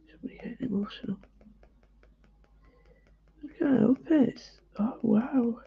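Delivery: tearful whisper, voice catching high; overcome, barely getting the words out; choked up. Real, not acted. Artist moved to tears by her own drawing, choked whisper. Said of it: "I'm sorry".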